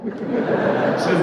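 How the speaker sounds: ordinary talk, nothing marked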